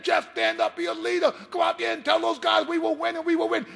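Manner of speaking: high, loud rallying coach shouting; fired-up pep talk. Retold or imitated. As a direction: roar the locker-room speech to rouse him. Coach's pep talk in locker room.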